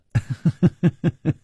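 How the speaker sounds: high, rippling titter; mocking giggle. A narrator's reading. Miss Ping laughs at her matchmaking jibe, giggling. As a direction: titter at your own joke. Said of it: "忽然笑着说".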